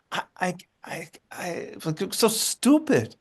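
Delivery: imitated stammering disbelief, near-whisper rising high; stunned self-reproach. Retelling grandmother's stunned self-reproach.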